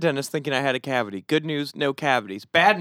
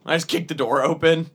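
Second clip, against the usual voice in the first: ordinary talk, nothing marked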